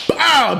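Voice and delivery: high, punchy impact sound; imitated punch noise. Narrator showing the attack, punchy imitated hit. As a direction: pop it out like a blow landing.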